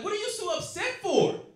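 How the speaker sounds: high, whiny mock voice of the ex. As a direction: make her sound clueless and chirpy.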